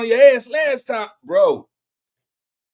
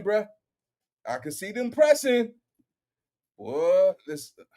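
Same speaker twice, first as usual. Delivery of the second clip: ordinary delivery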